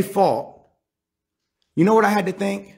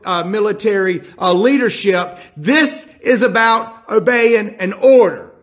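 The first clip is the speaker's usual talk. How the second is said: raised, insistent and higher, pressing hard; urgent pleading order; 5 semitones higher, 4 dB louder than usual. Acted. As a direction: lean on him, you need him to obey. Joab voiced pressing the messenger.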